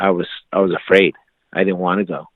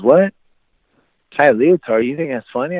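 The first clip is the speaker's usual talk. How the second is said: raised, indignant comeback; offended challenge; 6 semitones higher, 4 dB louder than usual. Retold or imitated. Wrestler friend to scoffer, taking offence. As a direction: imitate an affronted friend squaring up.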